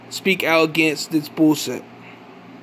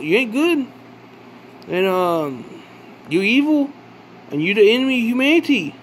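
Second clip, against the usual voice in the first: raised, higher accusing call; 4 semitones higher than usual. Real, not acted. Calling out to the enemy of humanity, raised and accusing. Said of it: "I'm just gonna call out".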